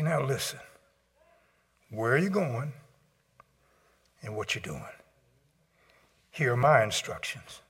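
God speaking softly and calmly; calm gentle voice. Pretend. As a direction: settle a frightened listener down. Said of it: "calm you down and say".

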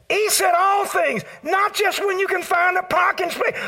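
high, loud shouting preacher; full-force preaching. Acted. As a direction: preach it out to the back pew.

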